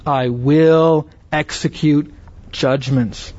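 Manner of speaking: slow, deliberate weight on "will"; stress on "will". Shown as a demonstration. Preacher quotes God's vow of judgment, stressing "will". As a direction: hold "will", then measure out the rest. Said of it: "note this emphasis on the word will".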